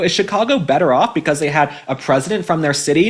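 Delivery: normal talking voice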